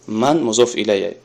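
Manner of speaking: ordinary talk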